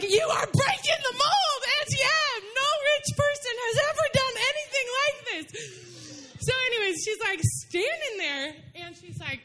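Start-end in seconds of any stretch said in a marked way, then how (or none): 0.0-5.8 much higher, tearful excited rush of thanks; overwhelmed gushing. Retold or imitated. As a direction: gush at Auntie Em, half laughing, half crying.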